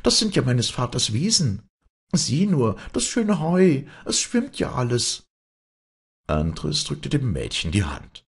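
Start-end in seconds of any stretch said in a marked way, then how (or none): 0.0-5.3 put-on high, raised girl's exclamation; crying out. A narrator's reading. Girl seeing her father's meadows flooded, crying out high. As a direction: cry it out in dismay, pitched high. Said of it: "rief Maren".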